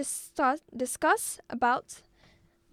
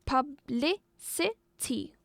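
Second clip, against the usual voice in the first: publicity split into pub, li, ci, ty; publicity in four separate beats; syllable by syllable; much slower than usual. Shown as a demonstration. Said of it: "This word has four syllables".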